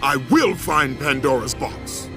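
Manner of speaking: fierce warrior's challenge; angry defiance. Acted. Kratos sends warning back to his former master, angry and defiant. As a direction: spit defiance at a hated master's servant.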